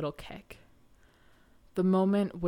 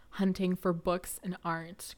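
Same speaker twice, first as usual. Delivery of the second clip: ordinary delivery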